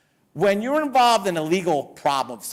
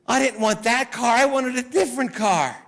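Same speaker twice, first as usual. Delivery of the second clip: high-pitched imitation of an upset client; mock complaint; 5 semitones higher than usual. Client to her lawyer after winning the car, mock complaining. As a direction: whine in mock protest, pitched up.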